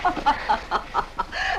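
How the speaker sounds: breathy scornful laugh; mocking laugh. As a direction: laugh him off cruelly. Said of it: "I'm laughing".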